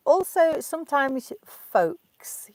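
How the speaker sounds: teacher showing the word "folks", slow and high; word held up slowly. Shown as a demonstration. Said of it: "you folks".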